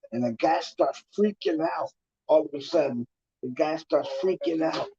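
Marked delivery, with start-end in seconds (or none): none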